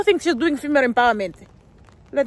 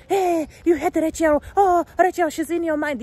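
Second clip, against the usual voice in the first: mocking commenter; 5 semitones higher than usual. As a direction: parody the accuser, much higher. Mocking commenters who accuse her.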